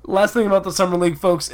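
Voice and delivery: quick no-nonsense restart; brisk restart. Real, not acted. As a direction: rush back on track. Restarting the outro firmly.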